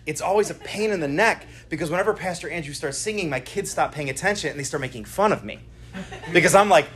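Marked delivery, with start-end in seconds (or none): none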